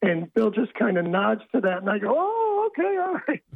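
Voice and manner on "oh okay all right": squeaky-high, meek retreat; imitating own nervous voice, pitched way up. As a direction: back down meekly in a very high voice. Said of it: "my voice goes up".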